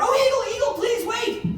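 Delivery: high, loud call across the river; shouting out. A narrator's reading. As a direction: call after the eagle before he flies off. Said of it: "she shouted out".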